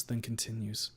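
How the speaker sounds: ordinary delivery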